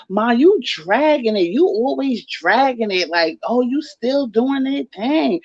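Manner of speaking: drawn-out teasing from a daughter; daughter's teasing. Retold or imitated. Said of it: "and my daughter said".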